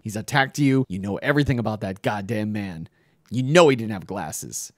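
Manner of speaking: are you kidding me, pile it on; exasperated outburst. Retold or imitated. Daughter berating mother about father.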